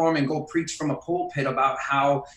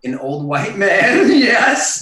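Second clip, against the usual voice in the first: louder, amused echo that breaks into a laugh; laughing agreement; 4 semitones higher, 7 dB louder, much slower than usual. Real, not acted. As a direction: repeat it and burst out laughing. Echoing co-host's joke, laughing.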